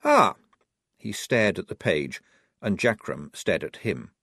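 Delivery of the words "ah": surprised exclamation. Acted. Sudden discovery in the book.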